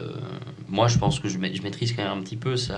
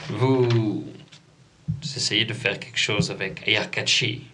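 slow, spaced-out shaman voice; dazed old shaman; slower than usual. Acted.

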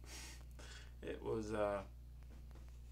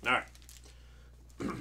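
usual speaking voice

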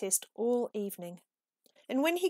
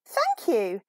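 chirpy; 2 semitones higher, 8 dB louder than usual. Acted. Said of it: "chirped a friendly bird".

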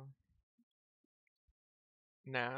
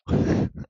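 laughing too hard to go on; loud helpless laugh; burst of laughter; 17 dB louder than usual. Real, not acted. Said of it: "I can't do a sad hamster".

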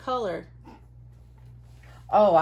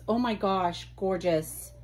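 normal talking voice

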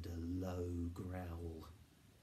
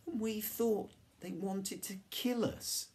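very high, louder exclamation; relieved amazement; 5 dB louder than usual. A narrator's reading. Children laughing over their mistaken fear, high relieved exclamation.

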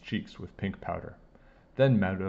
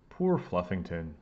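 plain reading voice

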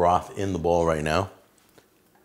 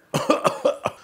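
coughing. Real, not acted. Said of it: "I'm burning up right now".

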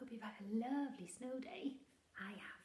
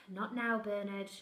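louder sighing brush-off; dismissive mother; 12 dB louder, slower than usual. Acted. Mother brushing off the monster, weary. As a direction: sigh and brush it off.

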